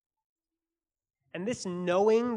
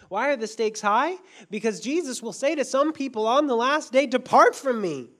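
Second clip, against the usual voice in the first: stakes rising, louder and higher; preacher's climax, louder and higher up to Depart from me; 1 building to a shout; 5 semitones higher, 7 dB louder than usual. Real, not acted. Preacher warning the church of judgment, loud and high.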